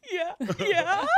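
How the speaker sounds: high, loud laughing yeah yeah; giddy laughing yes. Retold or imitated. Accepting the podcast invitation, high laughing excitement. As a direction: burst out with an eager, giggly yes.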